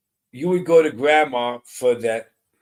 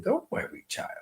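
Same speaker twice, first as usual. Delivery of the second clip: soft, lilting grandma comfort; lyrical grandmother voice; 13 semitones higher, 8 dB quieter than usual. Retold or imitated. Medium comforting a sitter, voicing the grandmother softly. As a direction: croon it like a soothing grandmother. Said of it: "or if she said".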